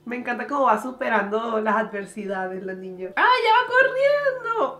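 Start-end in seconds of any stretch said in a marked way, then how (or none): 3.1-4.8 high, loud, stretched joyful cry; real thrilled squeal; delighted exclamation. Real, not acted. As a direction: burst out with delight. Delighted at girl running.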